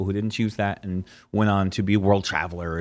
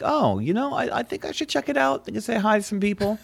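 higher, airy musing voice; inner thought voice; 8 semitones higher than usual. Retold or imitated. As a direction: voice your own excited thought. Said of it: "this is the first time I'm like".

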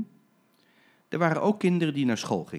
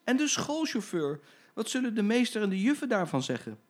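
put-on much higher childlike fretting; worried children; 10 semitones higher than usual. Schoolchildren worrying aloud to the driver, childlike. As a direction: worry about the teachers. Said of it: "riepen alle kindertjes".

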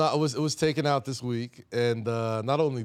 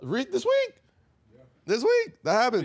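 sharp, much higher insistence; emphatic high answer; 13 semitones higher, 5 dB louder than usual. Real, not acted. Emphatic answer about sample removal.

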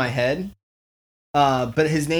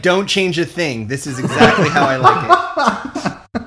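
laughing; 4 semitones higher, 6 dB louder than usual. Retold or imitated. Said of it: "Tom Cruise is like".

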